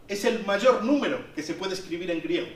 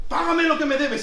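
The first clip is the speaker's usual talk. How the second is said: higher and louder demand; angry demand; 6 semitones higher, 4 dB louder than usual. Retold or imitated. Servant grabbing debtor on stairs, louder angry demand. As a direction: spit out the demand again.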